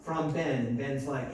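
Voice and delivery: usual speaking voice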